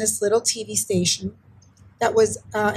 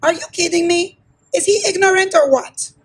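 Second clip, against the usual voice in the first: higher-pitched, incredulous scolding; indignant mother; 6 semitones higher than usual. Retold or imitated. Mother reacting to the kid at school who insulted her child, indignant. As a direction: act out a mom scoffing at an insult.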